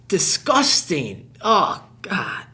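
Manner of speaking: loud, drawn-out revulsion; disgusted. Acted. Recruit who just ate a withered pear, loudly revolted. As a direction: groan the words out in disgust.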